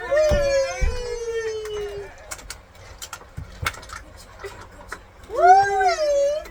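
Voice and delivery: high, long-held squeal of whee; gleeful whee. Real, not acted. Mother cheering toy cars down, gleeful whee. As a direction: stretch out a joyful whee.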